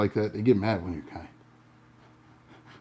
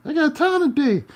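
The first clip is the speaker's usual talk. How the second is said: higher, louder grinning repeat; smiling taunt; 7 dB louder than usual. Retold or imitated. To the driver flipping him off, a grinning taunt. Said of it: "I'm smiling".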